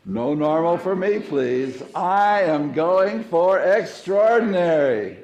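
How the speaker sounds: loud, high, slow congregational proclamation; rally the room into a shouted affirmation; said together with conviction. Shown as a demonstration. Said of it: "Let's say it together one time".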